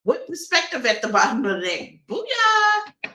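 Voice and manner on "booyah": high, bright "Booyah!" yelled in triumph; triumphant shout. Real, not acted. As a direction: celebrate the card like a win. Triumphant card reveal exclamation.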